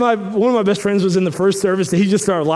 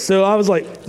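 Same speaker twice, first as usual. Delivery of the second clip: normal talking voice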